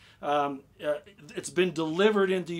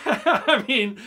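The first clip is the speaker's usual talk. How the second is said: laughing; 6 semitones higher, 8 dB louder than usual. Real, not acted. Laughs at having other things.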